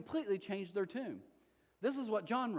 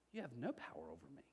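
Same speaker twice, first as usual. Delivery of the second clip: quiet calm reply; 3 semitones lower, 11 dB quieter, faster than usual. Retold or imitated. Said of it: "Now Jesus responds".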